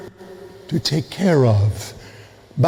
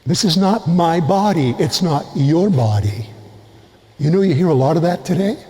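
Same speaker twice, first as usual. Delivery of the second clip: normal talking voice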